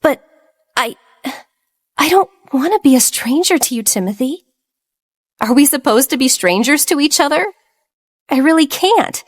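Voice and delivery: high, choked pleading through tears; choked with sobs. A narrator's reading. Evelyn pleading with Timothy, choked with sobs. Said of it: "she choked with sobs".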